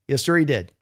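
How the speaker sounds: ordinary talk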